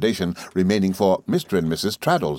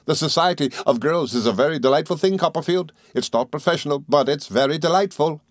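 higher-pitched eager, good-natured friend; Traddles, delighted; 8 semitones higher than usual. Acted. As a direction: lift the voice with open delight.

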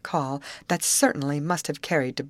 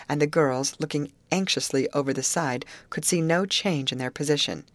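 ordinary delivery

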